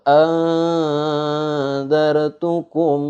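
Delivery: "Anzartukum" chanted high and slow with a long veiled n; ikhfa recitation. Shown as a demonstration. Teacher demonstrates nun meeting za, "Anzartukum" with a drawn-out masked n. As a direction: hold the blurred nasal in "Anzartukum" before moving on. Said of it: "atau nun mati ketemu za".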